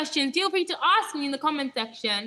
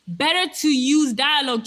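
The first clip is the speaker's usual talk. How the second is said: mock preaching; 4 semitones higher, 8 dB louder than usual. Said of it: "I'm already preaching to you the law".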